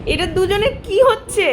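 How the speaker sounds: high-pitched, drawn-out mock scolding; amused, high exclamation; playful astonishment. Real, not acted. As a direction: squeal the question slowly. Playfully questions father and baby dancing.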